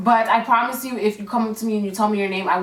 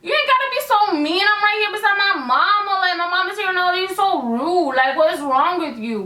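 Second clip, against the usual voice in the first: offended retort; 8 semitones higher than usual. Retold or imitated. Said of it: "And I was just like".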